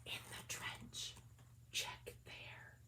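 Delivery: unvoiced soft whisper; hint it secretly; gentle hidden whisper. A narrator's reading. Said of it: "that soft voice whispered".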